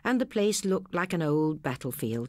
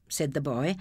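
usual speaking voice

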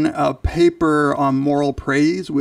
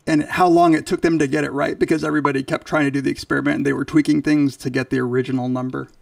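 ordinary delivery

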